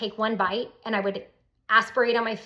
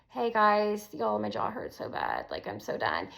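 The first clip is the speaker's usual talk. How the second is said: worn-out moan; 4 dB quieter than usual. Retold or imitated. Retelling her exhausted video messages.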